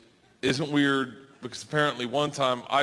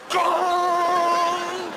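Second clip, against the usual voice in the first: high loud gong sound; silly vocal gong; mock gong; 13 semitones higher, 4 dB louder than usual. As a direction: ring out like a gong.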